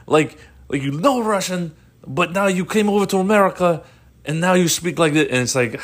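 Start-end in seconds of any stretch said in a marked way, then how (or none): 0.7-5.3 raised-pitch mock Russian accent. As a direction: play a Russian enforcer with a thick accent. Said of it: "now we speak Russian and just like this".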